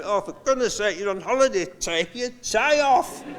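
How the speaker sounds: high, exasperated plea to take the tie off; exasperated nagging. Retold or imitated. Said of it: "I used to say to him".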